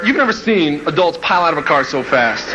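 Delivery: ordinary delivery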